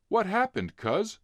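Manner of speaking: surprised question. Acted. Kids catching up to their cousin, startled. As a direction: ask it startled.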